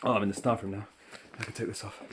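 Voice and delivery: slightly out of breath; catching breath after walking. Real, not acted. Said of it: "out of breath you can get from walking around the school".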